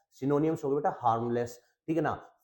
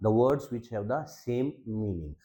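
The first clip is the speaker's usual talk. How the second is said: slow definition; 2 semitones lower, much slower than usual. Shown as a demonstration. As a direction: spell out each word slowly. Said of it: "teacher defines slowly".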